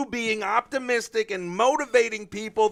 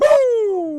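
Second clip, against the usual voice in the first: cheering woo; 12 semitones higher, 6 dB louder than usual. Real, not acted. Cheering as a hype man.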